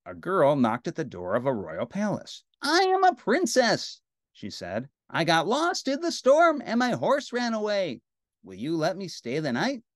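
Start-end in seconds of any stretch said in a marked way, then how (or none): 2.6-4.0 put-on high, loud girlish declaration; proud, high-pitched princess; storybook princess. A narrator's reading. Princess announces herself at door. 5.1-9.8 put-on storybook girl asking for shelter; pleading princess. A narrator's reading.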